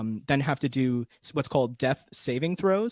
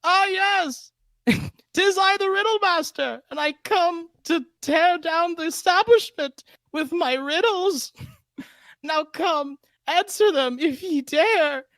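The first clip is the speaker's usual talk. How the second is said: much higher, grand, slow theatrical villain voice; Riddle Master voice; 7 dB louder, slower than usual. Acted. DM shows off his riddle-master character to the host, high hammed-up villain voice. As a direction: ham it up as a pompous riddle-telling villain, stretching the words. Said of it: "what is the Riddle Master's voice?".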